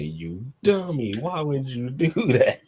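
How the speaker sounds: slow, high exasperated scold with a laugh; mock scolding. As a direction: drag it out at a dummy, pitched high. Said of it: "I'm like".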